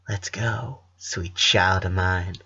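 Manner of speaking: hushed and slow, half-sung lyric; soft whisper. Acted. Sister to brother as she rises, hushed and slow. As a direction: breathe it gently to her little brother. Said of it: "she whispered".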